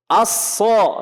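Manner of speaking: "Aso" with a heavy, thick s, lips not rounded; thick emphatic sad. Shown as a demonstration. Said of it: "coba perhatikan".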